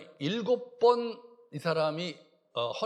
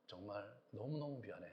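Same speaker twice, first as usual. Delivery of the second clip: put-on much softer, gentle plea to an older brother; tender apology; 9 semitones lower, 14 dB quieter than usual. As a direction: say sorry softly, heart open. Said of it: "그렇게 얘기해야 되잖아요".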